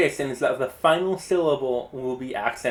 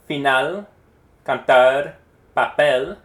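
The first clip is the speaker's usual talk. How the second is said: Final, Cantar, Papel each stressed on the last syllable; 7 dB louder, slower than usual. Shown as a demonstration. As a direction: punch the ends: fi-NAL, can-TAR, pa-PEL. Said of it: "I'm going to say a word".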